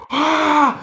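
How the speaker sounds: a high monster "ah"; Resident Evil monster scream. Retold or imitated. Retelling the fight again, the kid's monster scream. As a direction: shriek like a zombie breaking loose. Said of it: "parecia o Resident Evil, assim, ó".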